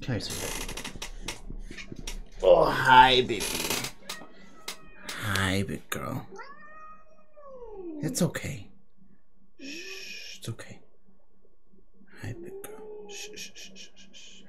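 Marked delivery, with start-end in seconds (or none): 0.0-1.0 softly cooing to a pet; gentle, hushed, tender coaxing; soft bedside voice. Real, not acted. 5.2-14.4 hushed soothing whisper; shushing gently to calm her; soft bedside voice. Real, not acted. Soothing a frightened pet.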